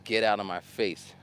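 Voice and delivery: ordinary talk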